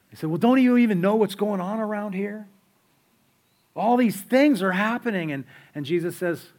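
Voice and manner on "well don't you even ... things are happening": higher, louder incredulous disciple; a bummed-out traveler amazed at the question; upset disciple. Retold or imitated. Disciple on the Emmaus road, higher and louder in disbelief.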